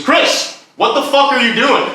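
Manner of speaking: high, exasperated acted outburst; scolding boss. Boss confronting high mic man, acted angry scolding. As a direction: imitate a boss tearing into an employee.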